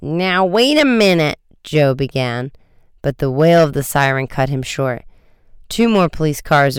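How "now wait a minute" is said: raised-pitch objection; indignant, drawn-in interruption; protesting. A narrator's reading. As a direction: object to a false accusation. Joe protests being accused.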